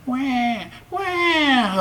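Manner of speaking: high mock baby crying wah wah; high wailing baby. A narrator's reading. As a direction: wail like a baby. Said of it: "a voice wailed".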